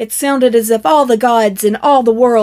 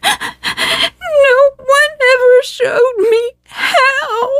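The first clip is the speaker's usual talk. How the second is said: much higher, slow, crying voice; sobbing; much slower than usual. A narrator's reading. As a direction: sob the words out, heartbroken. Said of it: "sobbed Attila".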